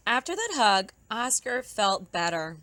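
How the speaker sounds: ordinary delivery, nothing marked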